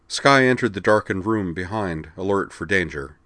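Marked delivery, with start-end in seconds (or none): none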